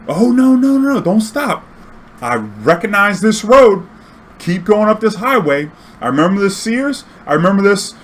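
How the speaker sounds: put-on high, pleading elderly woman, slowed; frantic old lady begging him not to stop; old lady passenger.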